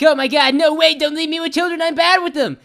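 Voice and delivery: high, loud, panicky begging; panicked plea. Retold or imitated. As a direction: plead in a rush not to be left alone. Begs mom not to leave.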